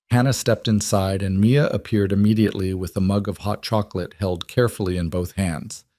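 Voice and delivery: plain reading voice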